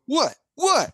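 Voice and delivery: mock innocence. Traveler to customs officer about the smelly car, mock innocent surprise.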